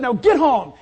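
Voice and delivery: loud, stern order to a dog; scolding command. Retold or imitated. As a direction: send the naughty dog off with a shout. Acts out ordering dog home.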